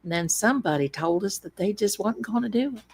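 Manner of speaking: plain reading voice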